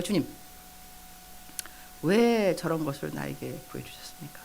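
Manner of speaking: soft, breath-light humble plea; hushed prayer. Retold or imitated. Believer praying to the Lord about what she saw, hushed prayer. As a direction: drop to a reverent near-whisper. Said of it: "근데 기도했다니까".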